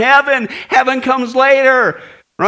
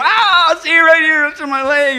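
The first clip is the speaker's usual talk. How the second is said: louder, higher mock complaint of pain; hammed-up pain; 6 semitones higher, 5 dB louder than usual. Joke lady complaining to her doctor about her leg, in mock pain. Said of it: "And then she touched her leg".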